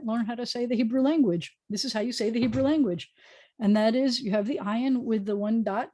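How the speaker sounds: usual speaking voice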